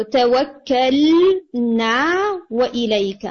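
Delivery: تَوَكَّلْنَا وَإِلَيْكَ recited slowly, lam clean and long vowels held; recitation demo. Shown as a demonstration. Teacher modelling the clean lam for the student, slow recitation. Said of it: "الف مدیہ بھی تھوڑا مس ہو گیا".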